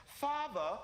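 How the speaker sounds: high, formal bougie son; Hassan. Retold or imitated. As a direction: address dad grandly, in a mock upper-crust voice. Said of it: "Hassan's a little different".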